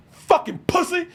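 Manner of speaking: high, loud mock-furious yell; imitated angry outburst; mad. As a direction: yell it like the scammer losing his temper. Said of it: "Guy got mad".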